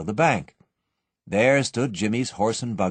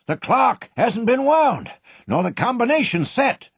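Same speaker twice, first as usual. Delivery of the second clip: put-on higher, louder, a despairing old man; groaning old banker; 13 semitones higher, 4 dB louder than usual. A narrator's reading. Old banker groaning at the locked vault, despairing. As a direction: groan it out in alarm. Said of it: "he groaned".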